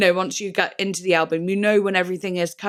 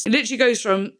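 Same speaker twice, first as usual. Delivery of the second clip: usual speaking voice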